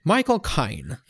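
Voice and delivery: put-on Cockney accent; Michael Caine. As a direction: do a mock London film-star voice. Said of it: "say in the Michael Caine accent".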